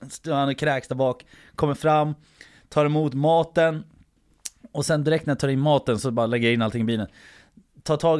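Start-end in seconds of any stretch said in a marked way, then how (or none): none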